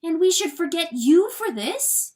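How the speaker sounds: shocked hen, high and loud; gasped. A narrator's reading. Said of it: "she gasped".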